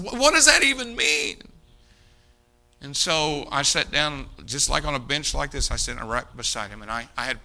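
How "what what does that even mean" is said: very high, loud bitter question; pitched-up anguished outburst; distraught. Retold or imitated. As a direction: challenge the preacher in despair. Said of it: "he's just distraught".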